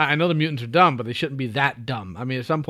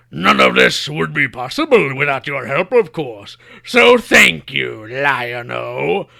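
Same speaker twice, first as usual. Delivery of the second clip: loud, slow, drawn-out villain speech; Mumm-Ra; 6 dB louder, slower than usual. Acted. Villain gloats to the hero about his remade planet, grandiose villain voice. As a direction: relish every word like a theatrical Bond villain. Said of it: "'cause in the next thing".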